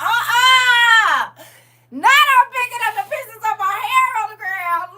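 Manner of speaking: high, loud laughing shriek; cracking up. Real, not acted. Host reading a viewer's comment about her hair, cracking up. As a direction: squeal it out through laughter.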